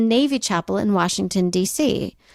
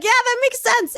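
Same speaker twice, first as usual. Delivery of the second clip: high-pitched mock agreement; high voice of gullible agreement; everyone agreeing; 14 semitones higher than usual. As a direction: play a credulous crowd, pitched way up. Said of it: "and everyone's like".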